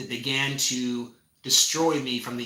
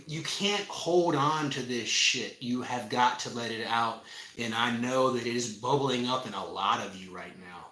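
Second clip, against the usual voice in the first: ordinary delivery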